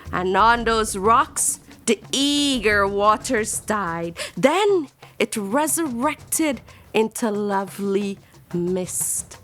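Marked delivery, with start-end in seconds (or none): none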